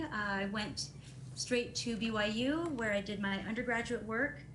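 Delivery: ordinary talk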